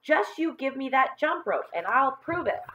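put-on high, defiant boy's challenge; insistent schoolboy comeback; retorting. A narrator's reading. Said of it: "John retorted".

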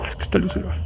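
whispering. Real, not acted. Tense night in a cemetery.

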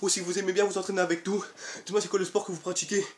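ordinary delivery

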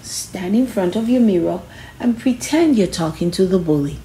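usual speaking voice

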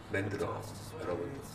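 ordinary delivery

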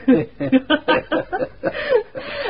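a high, ringing laugh. Acted. Laugh after names.

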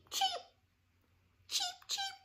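very high, soft chick cheep; chick peeps. Shown as a demonstration. Teacher peeping like a chick for the kids. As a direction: peep it like a tiny chick. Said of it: "Everybody say cheep".

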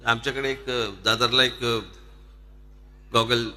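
ordinary talk, nothing marked

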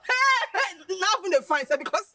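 loud high whooping laughter; laughing disbelief. Acted. Friend scoffing at the idea he wants her, laughing. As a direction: burst out cackling through the words.

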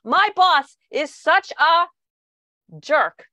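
mock fury unloaded on a partner after a bad day; furious venting. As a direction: vent about the boss as if still fuming. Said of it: "you say to your partner".

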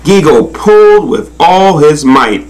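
ordinary delivery, nothing marked

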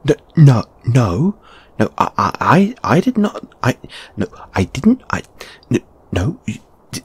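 high, stammering protest; panicked, tongue-tied objection; flustered denial. Acted. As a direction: splutter no over and over, pitch leaping.

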